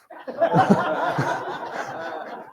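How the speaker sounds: breathy real laugh at the punchline. Real, not acted. Storyteller laughing at the toast mishap, real laugh. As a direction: laugh it out, airy.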